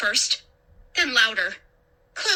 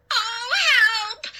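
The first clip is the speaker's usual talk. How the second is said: long, high cry for help; high-pitched panicked plea; 11 semitones higher than usual. A narrator's reading. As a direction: cry out desperately. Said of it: "I cried".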